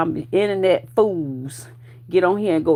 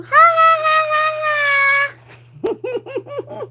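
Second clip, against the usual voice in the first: loud, much higher staged ha ha; mock laugh; 3 dB louder than usual. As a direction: ham up a taunting laugh. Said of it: "And I'ma laugh too".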